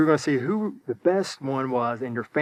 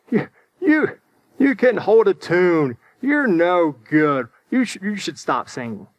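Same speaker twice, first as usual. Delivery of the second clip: mock put-down; 3 semitones higher, 4 dB louder than usual. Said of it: "instead of saying something wise, they say".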